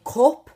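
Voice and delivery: cup with a rounded "oo" as in put; loud cup, said "coop"; Geordie cup. Shown as a demonstration. Teacher showing Geordie vowels, cup as "coop". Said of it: "'Cause in Geordie, I'd say".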